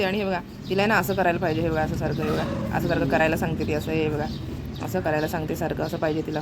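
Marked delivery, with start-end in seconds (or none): none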